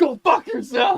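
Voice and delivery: loud high yell; furious shout. Retold or imitated. Shouting back at the neighbor through the window, furious yell. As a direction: blast it back at him.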